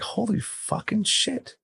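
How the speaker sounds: slow, high-pitched retold astonishment; stunned disbelief. Reacting to why the veteran is homeless, stunned.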